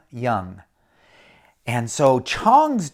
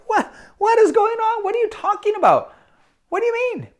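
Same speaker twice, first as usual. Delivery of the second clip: much higher, frantic questions; stunned disbelief. Acted. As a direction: squeal out the alarm. Master stunned, asking what's happening.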